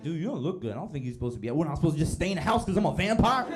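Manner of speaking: high, loud, offended protest; mock indignant vampire. Vampire protesting a friend telling him to stay in, loud and indignant. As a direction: bristle at being told to stay in. Vampires arguing about daylight.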